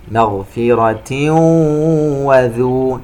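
slow chant, "مغفرة" hummed into the w of "وذو"; idgham with ghunna. Shown as a demonstration. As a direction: merge "مغفرة" nasally into "وذو". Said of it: "حرف الواو إدغام بغنة". (ترتيل بإدغام "مغفرة وذو" بغنة)

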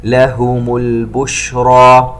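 البشرى with the ش hissed and spread, slow; spreading shin. Shown as a demonstration. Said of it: "نلاحظ التفشي". (البشرى بتفشي الشين)

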